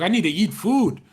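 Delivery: a hammed-up cry for food; desperate hungry exclaim. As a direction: blurt it out like you're starving. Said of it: "I'd be like".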